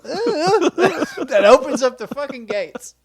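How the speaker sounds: high, loud laughing; giggling explanation. Real, not acted. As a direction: talk through laughter. Laughing at the flub.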